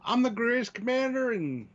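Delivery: high-pitched, boastful commander voice; odd commander voice. Pretend. Host plays a boastful commander, odd high voice. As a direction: put on a silly high voice for a grand commander. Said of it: "what the fuck voice I'm doing".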